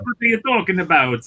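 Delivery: high-pitched, badly faked French accent; mock French accent. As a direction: protest in a muddled French-Italian accent. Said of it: "That was my French".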